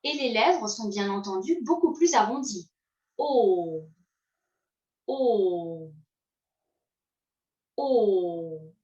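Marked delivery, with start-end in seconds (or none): 3.2-8.7 long rounded O, lips pushed forward; a teacher's slow model vowel. Shown as a demonstration. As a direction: hold the O round and low for the learner. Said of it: "Je vous écoute".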